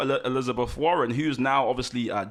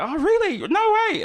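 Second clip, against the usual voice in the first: much higher and louder, squealing disbelief; mock surprise; 6 dB louder than usual. Friend retelling a model's shocked reply, high mock squeal. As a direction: shriek it like you can't believe it. Model reacting to scout's compliment.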